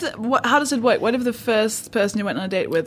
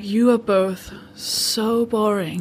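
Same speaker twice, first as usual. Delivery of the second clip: slow bored drawl; much slower than usual. Retold or imitated. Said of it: "where you're like".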